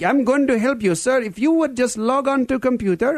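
put-on Indian scammer accent. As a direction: insist politely in an Indian accent. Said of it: "with a strange Indian accent".

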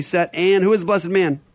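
usual speaking voice

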